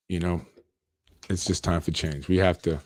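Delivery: ordinary talk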